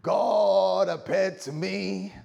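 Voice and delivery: loud, drawn-out, high American cry; American accent. Acted. Abraham announcing to his family, hammed-up American accent. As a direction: belt it out slowly in a put-on American accent. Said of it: "Sorry, that's my American".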